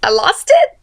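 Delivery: high, questioning admission; lightly acted confession, rising high; Frodo. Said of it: "And Frodo's like".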